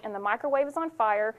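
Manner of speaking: normal talking voice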